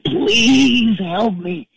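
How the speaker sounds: slow, high pleading moan; drawn-out mock plea; begging for help. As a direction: beg weakly like a hurt man in a wreck. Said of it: "he's going".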